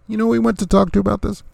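building outrage at the news; incredulous emphatic repeat. Real, not acted. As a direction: push the question up in disbelief. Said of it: "And do you know who he went to talk to".

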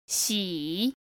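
喜 said low and dipping, third tone. Shown as a demonstration. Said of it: "That's a third tone".

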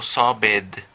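"sobbed" said in two syllables, sob-bed; wrong extra syllable. Shown as a demonstration. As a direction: add a full "-ed" syllable to "sobbed". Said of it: "Noten que yo no digo".

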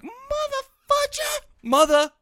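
very high, strained comic voice; high-pitched mock threat. Peter scaring away street hoodlums, high-pitched mock threat. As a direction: squeal it out at the hoodlums, hammed-up.

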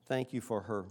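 earnest, measured prayer voice; closing prayer. Acted. Pastor closing the sermon by praying for the faithful lady, earnest prayer. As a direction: speak up to God for the congregation.

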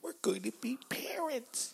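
high, soft excited whisper; whispered squeal. Pretend. Sharing news of adopting a dog, whispered squeal. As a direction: squeal it under your breath.

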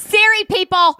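high, giddy exclamation; excited announcing. Real, not acted. Co-host hyping the famous guest to listeners, excited.

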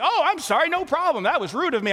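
high, breezy oh I'm sorry; brushing it off carelessly; lightly mocked flight attendant apology; airy apologetic guy. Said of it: "he was just like".

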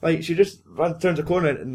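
ordinary talk

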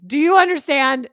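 very loud, high, emphatic demand; hammed-up shouted question; mock outburst. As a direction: imitate someone yelling to drive it home. Imitates Kathy's outraged demand.

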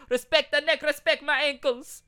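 high, loud mock Jamaican patois; fake Jamaican accent. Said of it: "spoke with a fake Jamaican accent".

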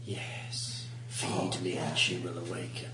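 breathy, hushed spirit voice; eerie whisper. Acted. GM voices a mysterious entity.

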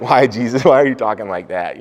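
loud, high, incredulous question; mock protest. Pastor pretending to question Jesus, loud and baffled.

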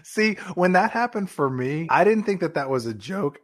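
ordinary talk, nothing marked

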